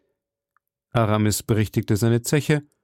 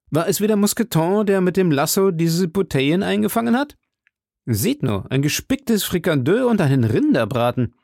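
higher, lively questioning voice; lightly voiced exclaiming musketeer; 8 semitones higher than usual. Guest marvels at bottles and roast, lightly exclaiming. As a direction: marvel at the food, pitch lifted. D'Artagnan exclaims over the meal.

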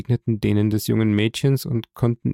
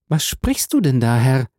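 put-on higher, questioning girl's voice; bewildered, raised young voice; puzzled young girl; 3 semitones higher, 3 dB louder than usual. A narrator's reading. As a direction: ask in wonder, pitched up. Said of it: "fragte das junge Mädchen".

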